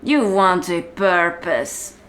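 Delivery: normal talking voice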